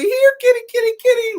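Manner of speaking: high, loud sing-song kitty call; calling the cat. Retold or imitated. Blind daughter calling the cat again.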